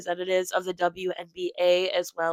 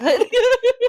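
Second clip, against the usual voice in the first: high, loud laugh burst; 9 dB louder than usual. Real, not acted. Laughing at bad-sounding remark.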